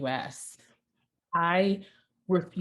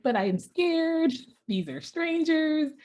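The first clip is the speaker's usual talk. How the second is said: put-on scared little girl; 11 semitones higher than usual. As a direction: plead your fear like a timid six-year-old. Six-year-old confesses fear to mom.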